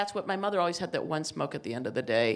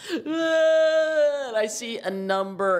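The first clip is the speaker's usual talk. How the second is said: slow, high, loud sobbing; retold tearful wail at the scale; crying; 6 semitones higher, 5 dB louder, much slower than usual. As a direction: wail it out through tears.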